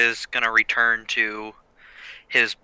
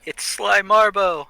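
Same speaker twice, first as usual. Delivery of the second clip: excited outburst; 11 semitones higher than usual. Real, not acted. Player excited joke reaction.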